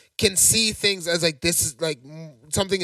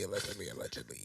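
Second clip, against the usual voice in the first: much lower, quiet and deep; deep mock lawyer voice; 12 semitones lower, 21 dB quieter than usual. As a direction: drop into a deep, hushed disclaimer.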